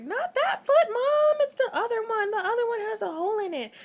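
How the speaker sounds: very high, loud cry half laughing; laughing panicked cry. Retold or imitated. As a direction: correct mom through laughter and panic. Child correcting mom, laughing.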